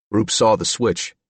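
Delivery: ordinary delivery, nothing marked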